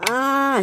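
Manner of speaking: high, drawn-out acted scream; falling cry. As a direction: cry out as you are swept away. Engine falls and is swept away.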